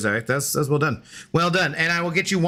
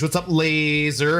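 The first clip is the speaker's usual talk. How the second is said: usual speaking voice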